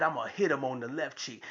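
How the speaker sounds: low, hushed, quick setup; understated announcement. Retold or imitated. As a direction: drop the voice and say it quickly. Father's calm setup, against daughter's reply.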